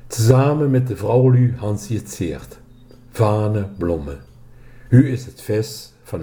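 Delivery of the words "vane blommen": ordinary delivery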